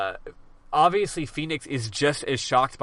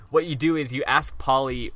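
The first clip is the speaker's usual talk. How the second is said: ordinary delivery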